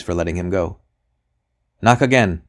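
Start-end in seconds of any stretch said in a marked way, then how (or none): 1.8-2.4 sharp raised call to knock; cried out. A narrator's reading. Magistrate to his men at the door, loud cry. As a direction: call out the order loudly. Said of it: "he cried".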